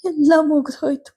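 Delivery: grieving mother leaning on ಎಲ್ಲ; ಎಲ್ಲ hit high, close to tears; tearful mother. A narrator's reading. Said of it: "ಕಣ್ಣೀರು ಗರೆದರು".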